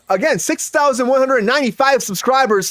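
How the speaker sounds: usual speaking voice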